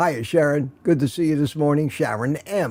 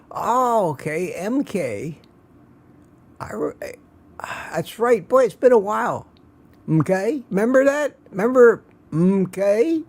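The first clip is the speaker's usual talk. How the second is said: Mister Mackey impression; 5 semitones higher than usual. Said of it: "The reference comes from South Park".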